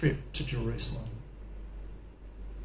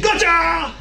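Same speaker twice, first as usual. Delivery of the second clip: excited; 13 semitones higher, 5 dB louder than usual. Retold or imitated. Gamer twitching at screen.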